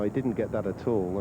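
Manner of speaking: ordinary talk, nothing marked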